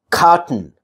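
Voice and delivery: cotton as cot-n. Shown as a demonstration. Teacher giving an example, cotton with bare N ending. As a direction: say cotton with T straight into N. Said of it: "I say cotton".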